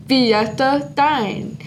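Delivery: slow, drawn-out "Fiesta Dine"; slow sign name. Shown as a demonstration. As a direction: stretch each word out for learners. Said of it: "teaching sign names slowly".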